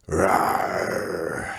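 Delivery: put-on a kid's bellowing bike-monster roar; monster growl. Fat kid roaring his battle cry across the schoolyard, bellowing growl. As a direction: roar like a beast spotting prey. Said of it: "his signature bellowing growl".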